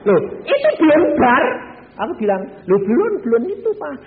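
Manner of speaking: high, loud excited exclamation. Retold or imitated. Wife teasing husband, high and loud. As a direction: burst out with the news. Preacher acting out wife's reply.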